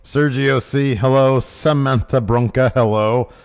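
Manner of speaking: loud, booming roll call; announcer. Acted. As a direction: call out every name like an emcee.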